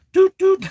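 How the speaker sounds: high, loud playful doot doot. Pretend. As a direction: chirp it like a toy horn. Playful noise.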